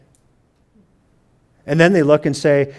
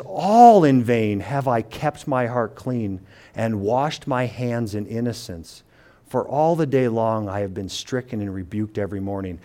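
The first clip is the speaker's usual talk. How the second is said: scripture reading; 3 semitones lower, 4 dB quieter than usual. Acted. Pastor reads psalm verse thirteen.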